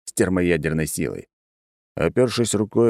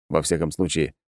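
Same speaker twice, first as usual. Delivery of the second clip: ordinary delivery, nothing marked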